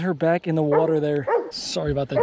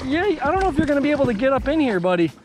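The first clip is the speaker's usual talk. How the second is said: higher, fast chatter to a pet; talking to a dog; 8 semitones higher, faster than usual. Real, not acted. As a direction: chat to the dog lightly.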